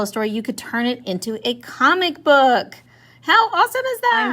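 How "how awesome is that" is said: excited. Real, not acted. Teacher excited to class.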